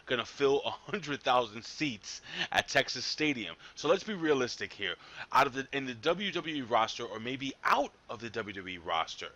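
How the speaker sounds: usual speaking voice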